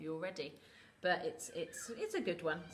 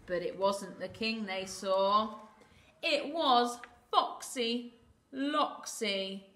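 suspenseful storytelling, slowing to a drawn-out reveal; story narration; 8 dB louder, slower than usual. A narrator's reading. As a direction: reveal the villain slowly to small listeners.

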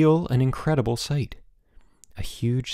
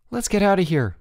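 raised, urgent plea; entreating; 8 semitones higher than usual. A narrator's reading. Boots begging the others to leave, entreating. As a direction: plead to leave, pitch lifted. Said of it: "Boots entreated".

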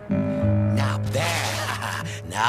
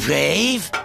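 incredulous echo; 11 semitones higher than usual. Acted. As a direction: throw the word back in disbelief.

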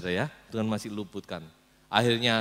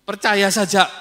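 brushing off; 9 semitones higher, 14 dB louder than usual. Acted. Ahab brushes off Yosafat.

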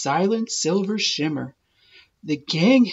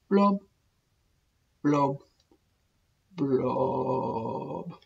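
mournful blub; 5 semitones lower, 7 dB quieter, much slower than usual. Acted. Fish's sad blub refrain.

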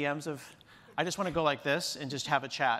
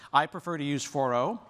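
ordinary talk, nothing marked

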